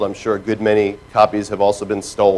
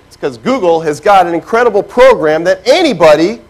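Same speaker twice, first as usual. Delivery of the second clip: usual speaking voice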